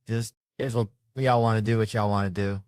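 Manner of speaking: usual speaking voice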